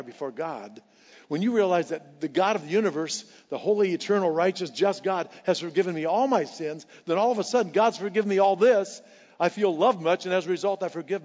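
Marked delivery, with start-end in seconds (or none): none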